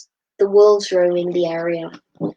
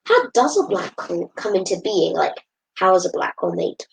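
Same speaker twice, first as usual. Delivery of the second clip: ordinary delivery, nothing marked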